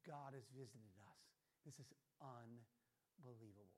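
very low, soft and slow; awestruck hush. Retold or imitated. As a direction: voice a crowd stunned into reverence. Voicing awestruck crowd in scripture.

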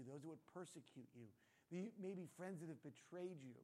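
usual speaking voice

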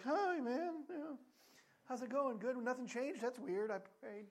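high, loud fake-cheerful greeting; awkward. Churchgoer dodging the friend he never prayed for, fake-cheery. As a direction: brightly cover the guilt.

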